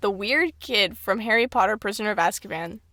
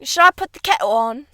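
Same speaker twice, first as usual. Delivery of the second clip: put-on British offer; light mock British accent; 3 semitones higher, 3 dB louder than usual. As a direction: ask it in an overdone British accent. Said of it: "British people".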